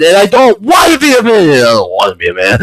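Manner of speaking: normal talking voice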